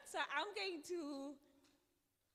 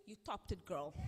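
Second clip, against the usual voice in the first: normal talking voice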